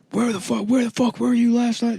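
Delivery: high, strained mock shout; angry agents yelling. Agents raid him.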